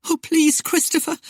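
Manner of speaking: high, pleading woman's voice; anxious, high-voiced entreaty; fearful cry. A narrator's reading. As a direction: plead in fear, pitched far up. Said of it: "Ariane cried fearfully".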